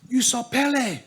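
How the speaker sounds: gasp, then a high amazed Pele; breathless awe at the goddess; shocked gasp. Acted. Parents realise the goddess.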